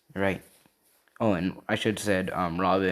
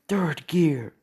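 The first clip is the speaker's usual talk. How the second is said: high, louder anime attack call; acted shout, pitched well up; battle yell; 9 semitones higher, 5 dB louder than usual. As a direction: yell out the name of your special move. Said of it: "you hear him yell".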